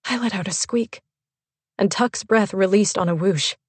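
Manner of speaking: ordinary delivery, nothing marked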